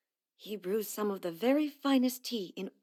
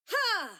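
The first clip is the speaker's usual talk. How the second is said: sharp attack shout; 12 semitones higher than usual. Acted. Attack grunts in battle.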